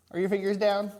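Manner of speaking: high, loud playful question; bright teacher call. Real, not acted. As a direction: call out to the class. Teacher calling to kids.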